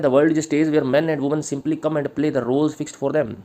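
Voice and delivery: slow, measured dictation pace, each word spaced; slow dictation to the class. Acted. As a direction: read it out slowly so students can write. Dictating answer lines.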